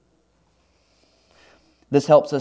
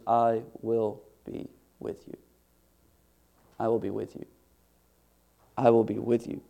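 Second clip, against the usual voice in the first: tender promise; 3 semitones lower, 8 dB quieter than usual. Acted. God reassuring wavering Moses, soft and tender. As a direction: lay the promise down softly, like a comfort.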